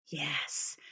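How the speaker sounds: whisper. Real, not acted. Teacher aside while reading.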